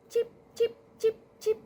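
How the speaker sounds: high, short chipping sounds; evenly spaced high chirped taps; imitated noise. Cat hears a noise in the kitchen, imitated chipping. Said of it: "he starts hearing this noise".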